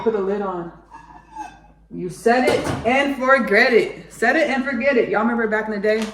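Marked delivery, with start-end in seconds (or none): 1.9-5.1 punchy, higher, louder TV slogan; shopping-channel pitch. Acted. Host slinging crock pots on QVC, loud sales pitch. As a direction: sell it like a crock-pot infomercial. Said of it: "slinging crock pots on Q V C".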